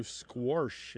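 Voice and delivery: "squash" said as "squorsh"; intrusive R. Shown as a demonstration. Host giving dialect examples, intrusive-R pronunciation. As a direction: put an R before the sh in "squash", slowly. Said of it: "sometimes you'll hear".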